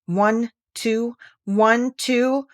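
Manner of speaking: slow, grand, declaimed verse; dramatic poem recital. Acted. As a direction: recite it like an epic ballad.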